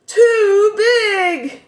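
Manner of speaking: shrill-free mock squeal of "too big"; a mocking high-pitched imitation of the principal; mocking high whine. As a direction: squeak the words up high and loud in mockery.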